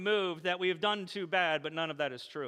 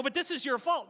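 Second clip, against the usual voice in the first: teasing accusation; 7 semitones higher than usual. Retold or imitated. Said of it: "And I go".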